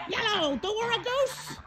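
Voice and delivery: soft cooing greeting. Real, not acted.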